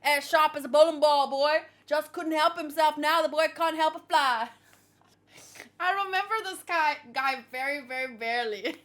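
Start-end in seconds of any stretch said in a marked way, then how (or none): 0.0-4.5 big cartoonish Texas accent; rough Texas accent. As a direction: holler it like a hammed-up Texan. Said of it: "how to do this guy's accent".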